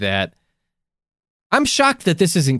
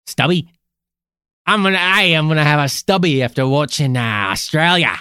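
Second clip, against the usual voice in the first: hammed-up Aussie bloke voice; mock Australian accent. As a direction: play a cartoon Australian. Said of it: "this authentic Australian accent".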